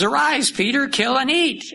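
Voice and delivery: voice from heaven. Acted. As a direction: call down to Peter from above. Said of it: "the voice from heaven says".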